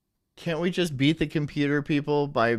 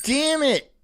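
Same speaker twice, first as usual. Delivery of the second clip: exasperated disappointment; 8 semitones higher, slower than usual. Real, not acted. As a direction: cry out in comic frustration, pitched way up. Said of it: "I'm disappointed that my sneeze went away".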